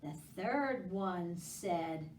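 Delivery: ordinary talk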